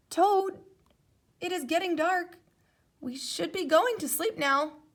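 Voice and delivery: a gentle friend urging bedtime; hint of a patient Frog. A narrator's reading. Frog's voice in story.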